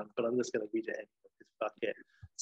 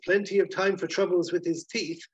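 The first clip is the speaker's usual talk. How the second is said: doctor; 5 semitones higher than usual. Acted. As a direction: pronounce the diagnosis in a higher doctor's voice. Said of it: "And the doctor says".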